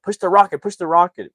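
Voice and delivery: high, loud, insistent chant; mock urgent urging. Narrator as family urging dad at rocket button, urgent urging. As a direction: egg the driver on loudly.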